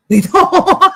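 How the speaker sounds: real laughing. Real, not acted. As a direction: crack up at your own joke. Cracks up at own joke.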